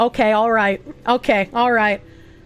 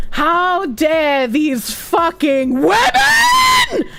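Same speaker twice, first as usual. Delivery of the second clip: slow, high theatrical indignation; mock outrage; 6 semitones higher, much slower than usual. Streamer mocking angry anonymous posters, hammed-up outrage. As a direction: draw it out in hammed-up high-pitched fury.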